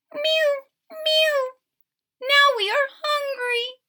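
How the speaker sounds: high squeaky kitten mewing; thirsty kittens. Acted. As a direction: squeak like tiny thirsty cats. Kitten voices again.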